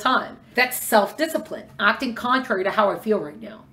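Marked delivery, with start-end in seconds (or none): none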